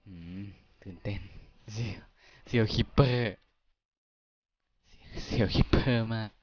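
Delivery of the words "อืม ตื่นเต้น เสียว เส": much softer, hushed by the danger; quiet tense murmur near a creeper; speaking quietly. Real, not acted. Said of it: "ก็คือพูดเบาลง".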